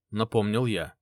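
ordinary delivery

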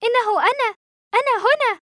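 high, urgent shout; excited high-pitched yell; shouted into phone. A narrator's reading. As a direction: cry out to be heard down a phone line. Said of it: "فصرخت".

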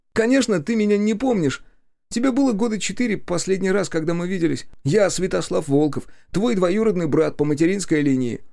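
high-pitched character voice through pain; a long-lost cousin, voice pitched well up; high voice of a battered relative; rescued cousin introducing himself. A narrator's reading.

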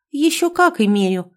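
narrated proud defiance; proud retort. A narrator's reading. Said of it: "гордо ответила женщина".

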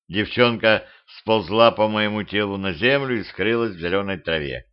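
plain reading voice

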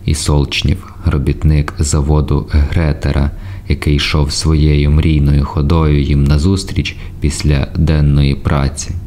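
plain reading voice

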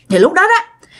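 ordinary delivery, nothing marked

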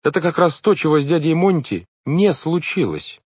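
put-on high, loud, emphatic boyish voice; eager correction. Klaus correcting Violet about their uncle, eager correction. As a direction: insist on it, pitched well up.

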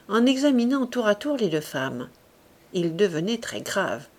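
ordinary delivery, nothing marked

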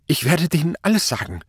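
high, desperate outburst; blurted out. A narrator's reading. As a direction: force it out in panic. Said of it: "stieß Kluge hervor". (verzweifelt hervorgestoßen)

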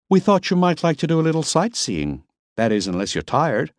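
Miss Lipp. A narrator's reading. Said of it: "Miss Lipp voiced".

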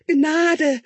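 high, loud plea; crying out. Acted. Tutor crying to the king for mercy, high and loud. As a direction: beg for mercy at full voice. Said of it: "rief der Hauslehrer".

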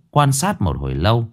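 ordinary delivery, nothing marked

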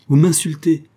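high, loud indignant charge; offended accusation. Acted. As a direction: accuse him sharply, raising the voice. Offended woman accuses her cousin. (accusation indignée, forte et aiguë)

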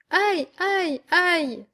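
high, squirming little yelps; high-pitched ticklish "ow"s, acted; ticklish cries. A narrator's reading. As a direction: yelp as if being tickled. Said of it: "d'une voix chatouilleuse".

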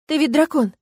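high, fervent, fully voiced; ardent urging. A narrator's reading. Woman eggs on the dragon, ardent. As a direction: press him with passion. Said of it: "пылко шептала я".

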